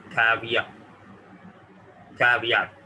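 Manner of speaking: caveat said "kaviyat", t barely heard; teacher models caveat; model pronunciation. Shown as a demonstration. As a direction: make the t of caveat minimal. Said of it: "तो मैं इसको यूं पढ़ूंगा".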